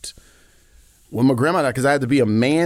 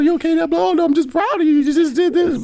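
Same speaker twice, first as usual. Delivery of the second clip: very high, loud, hammed-up emotion; mock choked-up; 5 dB louder, faster than usual. Dad retelling proud tears before his daughter, high and hammed-up. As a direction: act overcome with pride, voice pitched way up. Acts out tearful moment with daughter.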